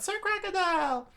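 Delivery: hammed-up damsel calling out to her hero; high-pitched acted scream; tearful woman's scream. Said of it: "in tears and s-, and screamed out".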